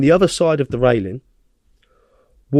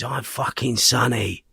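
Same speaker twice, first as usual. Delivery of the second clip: ordinary delivery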